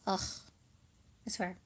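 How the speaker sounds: ordinary delivery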